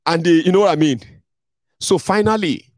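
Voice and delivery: normal talking voice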